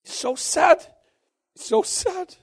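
choking up as he speaks; crying. Real, not acted. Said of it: "I'm sorry for crying".

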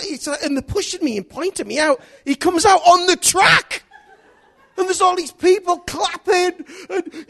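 loud, high, driving sermon storytelling; preaching at full force. Acted. Preacher telling the church the race story, loud and high. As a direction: build the story up to a roar for the congregation.

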